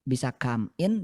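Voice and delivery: usual speaking voice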